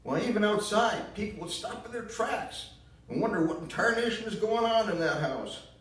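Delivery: put-on high, loud bitter accusation in a southern accent; bitter southern mother. Ma blaming Gramps for Gran's death, loud southern bitterness. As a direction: spit the blame out in a shrill Southern voice. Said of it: "she sobbed".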